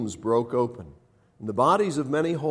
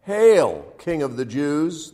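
loud, high hail, then slowing; mocking hail; 4 semitones higher, 7 dB louder, slower than usual. A narrator's reading. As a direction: hail him in mock homage, drawing out the title. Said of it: "knelt in front of him and mocked him".

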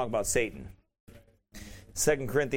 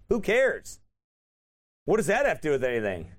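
normal talking voice

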